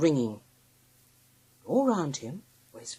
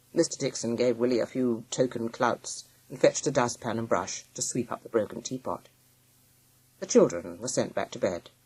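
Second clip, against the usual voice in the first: plain reading voice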